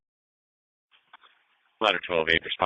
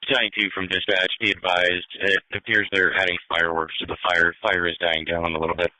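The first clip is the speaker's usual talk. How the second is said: police radio; 11 semitones lower, 3 dB louder than usual. Real, not acted. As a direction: voice over a radio channel. Dispatch heard over radio.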